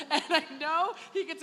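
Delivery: chuckling while telling the joke; laughing. Real, not acted. To parents, joking about Kieran's mom, laughing.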